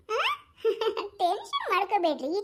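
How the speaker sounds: ordinary talk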